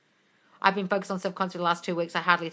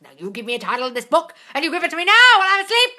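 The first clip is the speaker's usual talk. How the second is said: building from firm to high, loud and fast; retold screaming; 9 semitones higher, 10 dB louder, faster than usual. Author orders his brain to deliver the title, rising to a yell. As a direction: start firm, then explode into the demand. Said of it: "he was, like, screaming at it".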